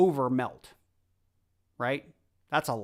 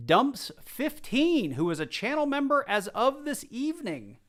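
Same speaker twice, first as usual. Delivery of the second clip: excited announcement; 7 semitones higher, 3 dB louder than usual. Real, not acted. Announcing the giveaway winner.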